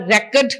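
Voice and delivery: verb record said as REcord; record wrongly stressed. Shown as a demonstration. Trainer showing the mistake, REcord stressed first. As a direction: put noun stress on record, REC first. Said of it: "it is wrong".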